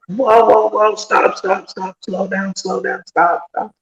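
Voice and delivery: high, urgent calls of whoa and stop; a Border Patrol agent yelling at the driver to stop, high and urgent; retold alarmed shouting. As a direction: wave the car down in a panic.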